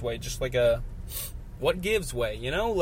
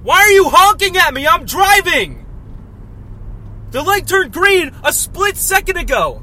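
much higher and much louder, real yelling; furious shout; 9 dB louder than usual. Real, not acted. Driver yelling at a honking car behind him, furious shout. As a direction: scream your outrage at the car behind.

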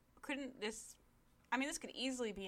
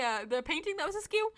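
raised, louder heckling question; incredulous mock questioning; 6 semitones higher, 6 dB louder than usual. Viewer asking the show about an ignored painting, incredulous. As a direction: push it up in exasperated disbelief.

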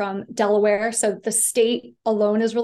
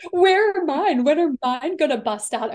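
high excited self-quote, louder at the start; eager teen wondering; 4 semitones higher, 3 dB louder than usual. Retold or imitated. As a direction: playfully act your impatient teenage self. Teen self wondering about breasts.

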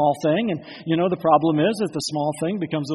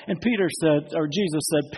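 ordinary talk

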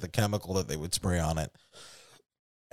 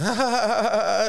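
high mock stoned laughter; imitated laughing fit; 9 semitones higher than usual. Bandmate right after the bong hit, mock hysterical laughing. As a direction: imitate a high, helpless cackle. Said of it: "he just starts laughing".

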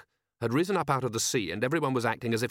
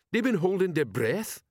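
dim troll, slightly higher; 7 semitones higher than usual. Acted. Troll sergeant asking his commander, dim troll voice.

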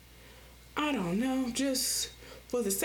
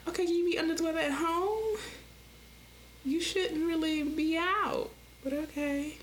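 sassy polite; 7 semitones higher than usual. Retold or imitated. Sassy reply to sick customer.

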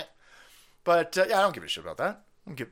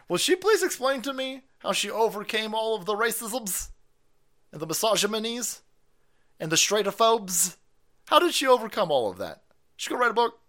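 mock innocent questions; 7 semitones higher than usual. Mock-naive questions, raised voice.

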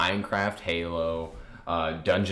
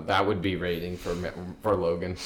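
ordinary talk

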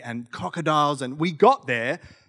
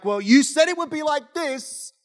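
high-pitched protest at a broken promise; acted peeved outburst; exasperated disappointment; 11 semitones higher than usual. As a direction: complain as if at the end of your tether. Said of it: "totally disappointed, totally at the end".

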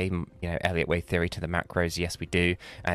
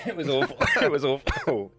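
laughing; 7 semitones higher than usual. Real, not acted. Laughing at the bad singing.

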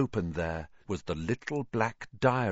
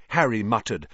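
ordinary delivery, nothing marked

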